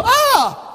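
mock yelp of pain. Struck by God's rod.

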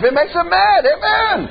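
high, driving preacher's cry against the devil; fiery preaching. Acted. Preacher rousing his congregation against the devil, fiery and high. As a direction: preach it hard to the young people.

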